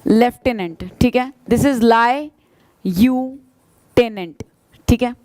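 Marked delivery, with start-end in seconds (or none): 1.5-4.5 slow, word broken into parts; split into syllables. Shown as a demonstration. Teacher splitting lieutenant for students. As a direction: spell it out in chunks. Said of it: "lie मतलब झूठ, you मतलब तुम".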